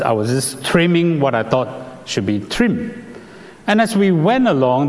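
usual speaking voice

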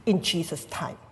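ordinary delivery

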